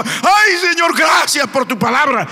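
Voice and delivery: imitating a worshipper crying out thanks, high and loud; hammed-up shout of gratitude to the Lord; mock grateful worshipper. Pastor imitates a worshipper thrown onto the platform, mock loud thanks. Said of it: "se tira a la plataforma".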